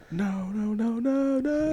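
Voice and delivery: high, climbing string of cries; mock panic. Mother watching her daughter wither, mock panic climbing high. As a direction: imitate mounting panic, pitch rising steadily.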